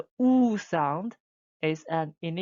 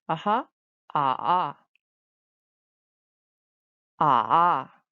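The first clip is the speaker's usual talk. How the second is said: "ah, ah" dipping low, then climbing; fall-rise tone; 3 semitones lower, 4 dB louder than usual. Shown as a demonstration. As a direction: drop the "ah" low and bring it back up. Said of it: "it's a four rise tone".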